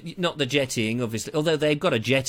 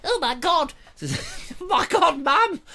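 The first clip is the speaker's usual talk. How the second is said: put-on much higher, shrieking child calling for mum; terrified kid; 13 semitones higher than usual. Scared child calling to mum about the stilt walker, high shriek. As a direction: squeal like a scared little kid.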